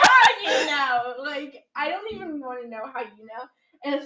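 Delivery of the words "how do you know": loud, slow, drawn-out incredulous question; mock disbelief. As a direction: blurt it out aghast, hammed up. Retold startled reply to churchgoer.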